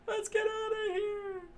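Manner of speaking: very high panicked yell; screaming crowd. A narrator's reading. Crowd flees the restaurant, high panicked yell. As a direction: scream to flee. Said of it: "the crowd screamed out".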